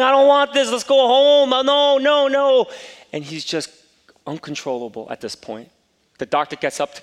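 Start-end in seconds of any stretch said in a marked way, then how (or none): 0.0-2.7 put-on high, loud, pleading little-boy wail; crying child. Five-year-old begging his father to go home, crying. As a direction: beg to go home through tears. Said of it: "like, crying".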